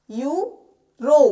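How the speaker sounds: Euro very slowly, यू rising, a gap, then a loud रो; split into syllables. Shown as a demonstration. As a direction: stretch euro into two separate beats, यू ... रो. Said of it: "ई और य को मिलाया तो यू". (Euro को तोड़कर, बहुत धीरे: यू ... रो)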